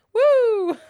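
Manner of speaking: high loud woo; woo howl. Shown as a demonstration. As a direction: whoop with excitement. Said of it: "I almost just did a".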